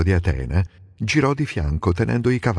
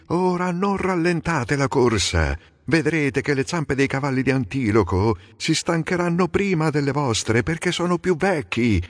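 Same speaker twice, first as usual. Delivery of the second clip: shouting to horses; 7 semitones higher than usual. A narrator's reading. Menelao urging his own mares on, high calling. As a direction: drive the mares on, pitch up high. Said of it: "E poi gridò ai cavalli".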